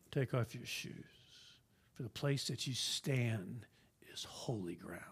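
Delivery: soft, low, dropping to a whisper; hushed voice of God. Acted. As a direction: speak it hushed like holy words. Quoting God at burning bush.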